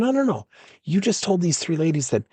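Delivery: high-pitched retold interruption; insistent objection. Acted coach cutting off the employee.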